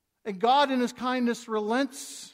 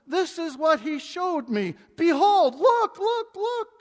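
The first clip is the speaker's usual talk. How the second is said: rising higher and louder with each look; look, look, look, climbing higher and louder; building excitement; 8 semitones higher, 7 dB louder, slower than usual. Acted. Preacher urging the congregation to see the vision, building to a high loud call.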